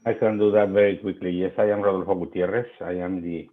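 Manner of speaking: ordinary delivery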